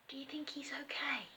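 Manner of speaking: worried whisper. A narrator's reading. Terry whispering after the dog is squished. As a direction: breathe it out under your breath. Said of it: "said Terry".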